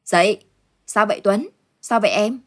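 loud, worried waking call; urgent calling. Acted. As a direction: shake them awake loudly. Woman shakes them awake.

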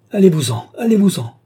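loud, high, falling angry shouts; convulsed cry. A narrator's reading. Charity throws Ali out, crying out furiously. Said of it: "Charity s'écria avec une figure convulsée".

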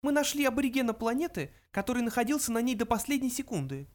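high little mouse voice; high-pitched small creature. A narrator's reading. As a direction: state it as a tiny mouse. Mouse character speaks.

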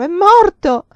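high, very loud anguished cry; scream. A narrator's reading. Vinca fearing her beloved is dead, screaming. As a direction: cry it out at full force. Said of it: "Vinca dette un urlo".

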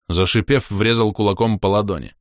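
ordinary delivery

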